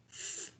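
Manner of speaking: "th" as in "thing", breath only without buzz; unvoiced th. Shown as a demonstration. Teacher contrasting it with "there", voiceless th. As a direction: hiss the "th" with no voice. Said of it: "And that's different from the".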